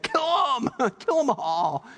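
high shouted command with a laugh; rally the troops, half laughing; battle cry. Retold or imitated. Elijah ordering his men to kill the prophets, high yell.

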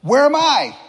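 high, loud excited question; mock-excited self-centred cry; eager searching. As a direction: blurt it out, hunting for yourself. Acts eagerly searching a yearbook.

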